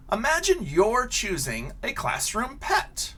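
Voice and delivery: lively picture-book read-aloud; reading to children. Acted. Teacher reading a picture book to her class, animated storytelling.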